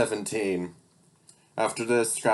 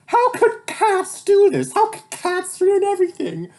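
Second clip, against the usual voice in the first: villain yelling; 6 dB louder than usual. Acted. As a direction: rant at the sky in disbelief. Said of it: "Then he yelled".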